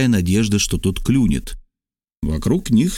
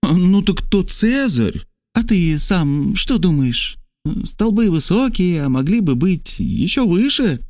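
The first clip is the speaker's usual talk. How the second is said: Trasul fishing for an answer; 8 semitones higher than usual. Acted. Trasul baits the stranger about Caesar, high probing character voice.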